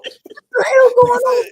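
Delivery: loud, high, drawn-out cry of disbelief; alarmed shout. Retold or imitated. Reacting to the video of the fight, alarmed shout. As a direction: yell it out in mock shock at the brawl.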